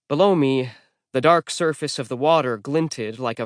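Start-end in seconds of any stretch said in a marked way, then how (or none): none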